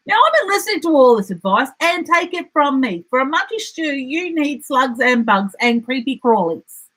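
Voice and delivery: high, fast know-it-all echidna; bossy echidna. A narrator's reading. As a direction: rattle off the advice.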